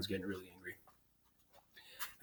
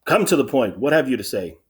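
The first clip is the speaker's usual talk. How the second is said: raised, impatient royal demand; annoyed; 5 semitones higher, 13 dB louder than usual. Acted. King tells the rambling sentry to get on with it, annoyed. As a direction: press for an answer, mildly irritated. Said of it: "starts to get annoyed".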